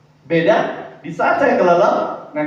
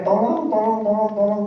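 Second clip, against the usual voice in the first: mock cry for help; 6 semitones higher than usual. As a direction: pretend to be drowning and call for help. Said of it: "Dan saya teriak".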